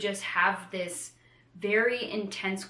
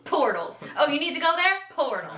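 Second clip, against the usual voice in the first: mock Rand voice; 7 semitones higher, 6 dB louder than usual. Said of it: "he's like".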